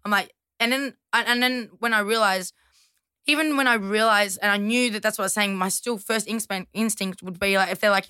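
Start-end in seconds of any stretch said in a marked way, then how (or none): none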